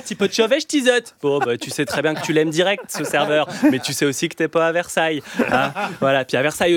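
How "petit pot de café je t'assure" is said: higher pitched ch'ti patter; a friendly northern waiter, mildly hammed; ch'ti accent. Le serveur d'Arras sert le client en patois ch'ti. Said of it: "quand le serveur te dit".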